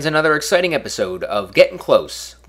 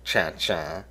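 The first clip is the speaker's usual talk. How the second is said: bad Dennis Miller; 6 semitones lower, 7 dB quieter than usual. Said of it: "the worst impression I've ever done".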